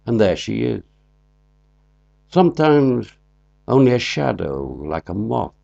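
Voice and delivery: measured poetry recital with long pauses between lines; poem reading. Acted. Poet reads his poem after explaining its title, measured recital. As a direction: read it out deliberately, each line set apart and projected.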